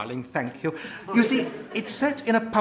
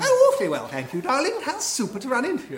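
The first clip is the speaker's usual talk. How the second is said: put-on posh English accent; 2 semitones higher than usual. Posh English actor greeting old friend, plummy accent. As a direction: gush like a posh English luvvie. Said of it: "But you sound so".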